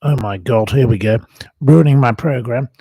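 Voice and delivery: loud exasperated groan at interruption. Real, not acted. Annoyed at interruption.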